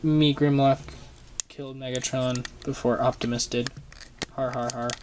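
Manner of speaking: usual speaking voice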